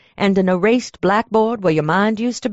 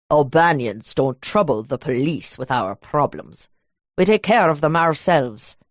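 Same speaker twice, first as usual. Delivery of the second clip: a lower, measured man's voice; lowered male voice; 5 semitones lower than usual. Acted. Derek introducing himself to the clerk, low and measured. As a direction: play the cold, polite stranger, low.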